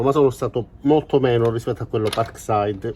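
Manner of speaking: ordinary talk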